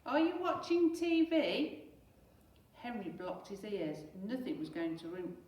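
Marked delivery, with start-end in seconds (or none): 0.1-1.8 high, loud and slow; mother yelling from another room; exasperated shout. Acted. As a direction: call out accusingly. Mum shouting from kitchen.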